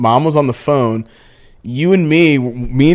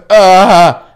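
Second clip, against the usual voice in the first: loud strained cat grunt; mock birthing grunt; 4 semitones higher, 7 dB louder than usual. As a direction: push out a pained cat grunt.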